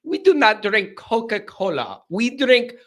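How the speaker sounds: put-on lampoon a pampered rich fan in a made-up accent; hammed-up snobby made-up accent; mock posh accent. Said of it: "I don't know what accent that is".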